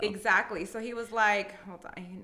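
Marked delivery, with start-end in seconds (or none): 1.6-2.2 quiet aside. Real, not acted. Phone buzzes mid recording.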